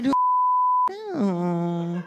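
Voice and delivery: very high drawn-out no; cooing mall dad; mock rich dad. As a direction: stretch the no sweetly. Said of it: "yang kayak".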